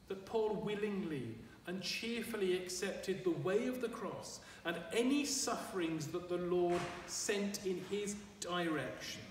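usual speaking voice

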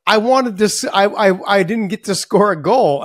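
high, loud mock-tearful child's wail; imitating a crying daughter. Dad retelling his daughter after her soccer game, mock tearful wail. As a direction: play the heartbroken little girl, half in jest. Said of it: "she just broke down in tears".

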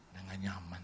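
low confidential whisper to a spouse; hushed, voiceless murmur of discomfort; whispered aside. Retold or imitated. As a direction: whisper it privately to your wife. Confides to his wife.

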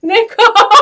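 high, loud mock call for a lost friend; mock search party calling Nicole. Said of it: "He's walking around going".

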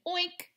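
high little pig grunt "oink"; a cute high oink. A narrator's reading. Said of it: "says Mercy".

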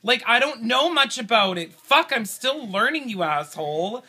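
loud, high, frustrated yelling; exasperated shouting. Real, not acted. Husband yelling at his wife over the quiz, frustrated shout. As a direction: blow up at her for rushing him. Flustered husband snaps under time pressure.

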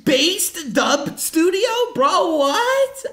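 real excited amazement; thrilled disbelief. Real, not acted. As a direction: gush with delighted disbelief. Amazed at the dub line.

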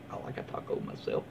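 a secret little confession, whispered; whispered aside. Real, not acted. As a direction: whisper it to yourself. Quiet aside about burritos.

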